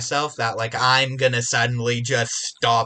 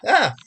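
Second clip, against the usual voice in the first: loud sudden sneeze; big explosive sneeze into the mic; sneezing; 10 semitones higher, 6 dB louder than usual. Real, not acted. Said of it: "And I need to sneeze now".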